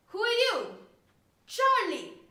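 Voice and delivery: loud, high interrogation of his son; demanding father. Acted. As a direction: challenge the child loudly. Angry father questions his son.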